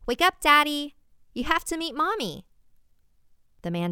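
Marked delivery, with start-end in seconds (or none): 0.1-2.5 calling out. Acted. Girl calling to sleeping man.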